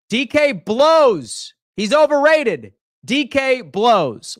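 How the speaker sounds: slow taunting put-down; slow emphatic trash talk. Real, not acted. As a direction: drag out D K blows mockingly. Trashing DK Metcalf.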